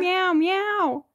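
high-pitched double meow; cat meow. Shown as a demonstration. Parent echoing toddler's cat sound, high meow. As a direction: mew like a kitten. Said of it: "What does a cat say?".

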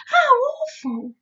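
high, loud cry of dismay; horrified cry. A narrator's reading. Girl hears of screaming plants, high horrified cry. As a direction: exclaim in acted horror. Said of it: "Sophie cried".

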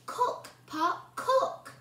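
sung-out magic words rising high. A narrator's reading. Hannah chanting the spell at her pot, rising.

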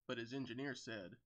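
ordinary delivery, nothing marked

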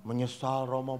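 low, soft reply; remorseful girl. Retold or imitated. Girl tells priest she is sorry, soft meek voice. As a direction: answer meekly and quietly. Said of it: "saya tanya anak perempuan".

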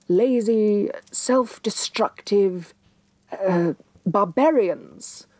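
slow, reluctant, picking each word; grudging rewrite. Acted. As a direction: drag it out as if it pains her.

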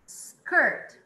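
Skirt called out loud; model word loud. Shown as a demonstration. Teacher calls out Skirt loudly for students. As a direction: project Skirt to the class. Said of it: "você tem skirt".

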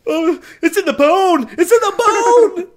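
high-pitched goofy agonized wail; Ace Ventura. Acted. Host spoofing the arrow pulled from the leg, hammed-up pain. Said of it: "like it's freaking Ace Ventura".

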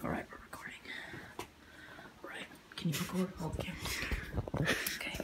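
soft sneaky whisper. Real, not acted. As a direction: whisper so nobody wakes. Whispering beside sleeping friend.

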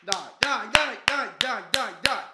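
loud urgent chant of dai; shouting. Real, not acted. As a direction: push them on at full voice. Fan urging attack.

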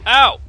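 sharp, high, loud ow; sudden yelp of pain. Real, not acted. Player struck by the creeper, startled loud yelp. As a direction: yelp as if just hit. Hit in the game.

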